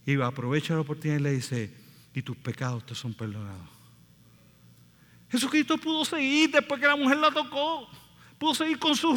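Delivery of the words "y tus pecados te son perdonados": tender. Retold or imitated. Jesus forgives the woman.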